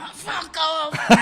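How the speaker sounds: high, slow, laughing fuck off; laughing brush-off. Retold or imitated. Comedian mocking the well-wishers before her gig, laughing. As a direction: laugh it out as a mock dismissal.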